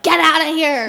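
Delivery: loud, high retold scolding shout; high, loud mock telling-off. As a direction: shoo her off like a playful scold. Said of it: "and then I'm scolded".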